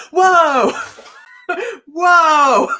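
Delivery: loud, high, laughing alarm; startled whoa. Retold or imitated. Hypnotist startled as her subject relives a hanging, laughing shout. Retelling the frightening hypnosis moment.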